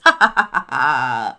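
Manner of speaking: low, loud booming bird laugh; owl laughing. Acted. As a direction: let out a deep gloating guffaw. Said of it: "Der Uhu lachte aus vollem Halse".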